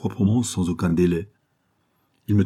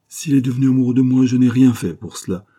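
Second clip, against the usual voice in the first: higher, louder and fast, flaring up in protest; indignant outburst; 5 semitones higher, 5 dB louder than usual. Acted. The girl protesting to the narrator about the abbé, fast indignant outburst. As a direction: snap back, hot with indignation. Girl retorts after the slap.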